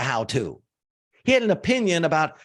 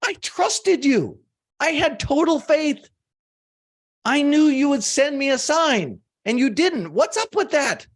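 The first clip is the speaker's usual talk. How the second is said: upset complaint; 9 semitones higher than usual. Retold or imitated. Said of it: "He looks at God and goes".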